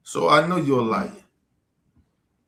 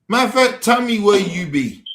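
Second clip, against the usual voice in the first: loud, higher demand; angry dare; 6 semitones higher, 6 dB louder than usual. Real, not acted. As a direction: bark the challenge at him. Daring a viewer to meet him.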